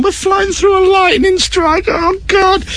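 high, slow panic about the lightning; panicked thought. Retold or imitated. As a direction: squeal it out, terrified. Panicking quote on stormy flight.

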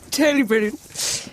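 put-on plummy posh English accent. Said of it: "that horrible posh voice".

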